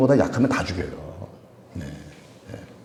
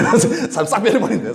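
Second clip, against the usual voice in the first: laughing; 9 semitones higher, faster than usual. Real, not acted. Laughing retelling of pastor change.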